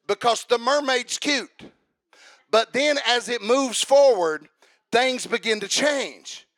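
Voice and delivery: preaching at full force. Acted. Preacher warning the congregation, building to a loud climax.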